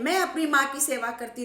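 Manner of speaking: loud tearful cry; shouting. Retold or imitated. Daughter shouting at her father, tearful and loud. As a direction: yell it at father. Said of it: "बेटी भी चिल्ला रही है".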